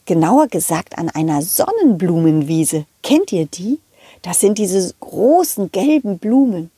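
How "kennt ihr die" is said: ordinary delivery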